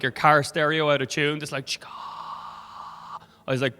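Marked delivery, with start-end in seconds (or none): 1.7-3.8 long unvoiced static hiss. Passenger imitating the broken intercom, static hiss. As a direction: hiss like an untuned car stereo.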